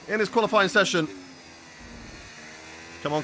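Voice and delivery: ordinary talk, nothing marked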